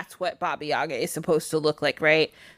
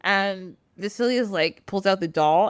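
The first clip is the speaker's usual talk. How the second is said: ordinary delivery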